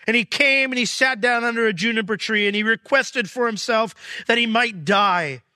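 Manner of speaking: high, loud, driving sermon voice; fervent preaching. Acted. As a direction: preach it out to the whole congregation. Preaching at full force.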